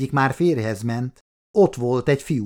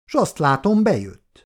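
sly smile; 6 semitones higher, 3 dB louder than usual. A narrator's reading. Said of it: "mosolygott kajánul Anita".

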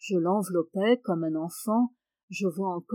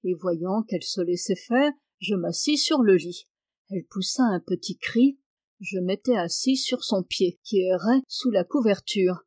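usual speaking voice

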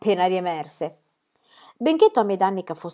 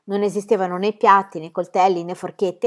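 usual speaking voice

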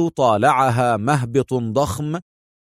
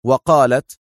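plain reading voice

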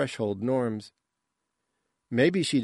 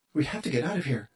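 plain reading voice

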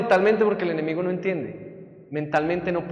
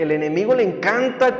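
ordinary talk, nothing marked

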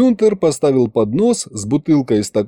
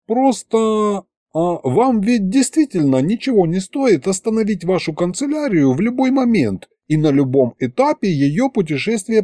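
high, polite archivist; 8 semitones higher than usual. A narrator's reading. Archivist Vitaramus voiced.

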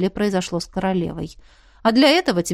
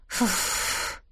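soft, voiceless exhale; flustered puff; 8 dB quieter than usual. A narrator's reading. Heroine admiring his muscles, flustered puff. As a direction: puff out air to cool off.

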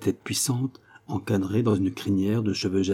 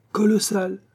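pitched way up on one word; amazed exclamation; 11 semitones higher than usual. Acted. Lagenevray interrupting, amazed, much higher. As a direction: burst out, astonished. Lagenevray reacts to fortune news.

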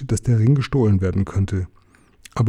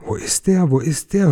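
high-pitched frantic cry at moderate volume; narrated wail, held back in volume; 5 semitones higher than usual. A narrator's reading. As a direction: cry out in a raised pitch, without real shouting. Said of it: "hörte der Hobbit ihn schreien".

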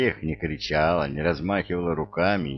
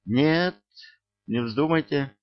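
sharp, high-pitched protest; alarmed cry; 8 semitones higher than usual. A narrator's reading. Frightened woman objects to him meeting her husband, alarmed cry. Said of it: "На крик обернулись".

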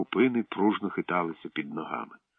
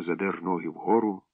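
ordinary delivery, nothing marked

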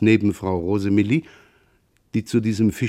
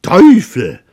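loud, higher curse with drawn-out stress; sudden outburst; 5 semitones higher, 9 dB louder, slower than usual. A narrator's reading. Father breaking a long motionless silence, sudden loud cry. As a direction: call it out in sudden exasperation. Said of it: "rief plötzlich Vater Roland".

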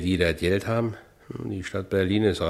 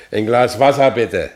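loud, higher demand; demanding, raised voice; urgent plea for water; 4 semitones higher, 9 dB louder than usual. Acted. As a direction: call out urgently for a glass of water. Pained man demands water.

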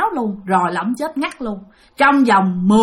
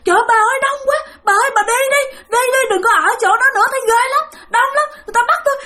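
very high, strained panicked cries; dying husband wailing in terror to his wife; terrified wailing; 13 semitones higher, much faster than usual. Retold or imitated. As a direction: wail like a dying man being seized. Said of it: "vừa dãy vừa la".

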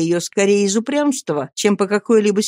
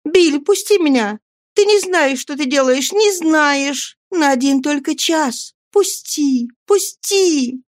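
desperate high-pitched begging; urgent, insistent high entreaty; resolute plea; 8 semitones higher than usual. A narrator's reading. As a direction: plead frantically in a high female voice. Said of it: "тоном решительной мольбы".

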